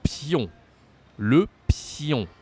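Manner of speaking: 'pion' as p-yon with a closed nasal ending; teacher's careful 'pion'; pronunciation demo. Shown as a demonstration. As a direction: model 'Le pion' clearly, no N sounded. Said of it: "we have to close more the mouth to say it. So".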